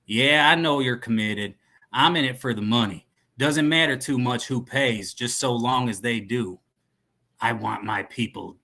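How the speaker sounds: cowboy arms dealer; cowboy accent. Acted. Dealer telling his contact he's in it for money, cowboy accent. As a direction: talk business like a movie cowboy. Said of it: "Cowboy guy".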